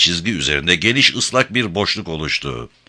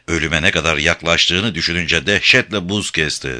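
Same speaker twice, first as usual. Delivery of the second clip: plain reading voice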